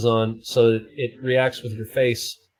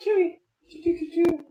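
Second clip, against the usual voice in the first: high sing-song cat call. Real, not acted. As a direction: call the cat from across the house. Calling the cat again.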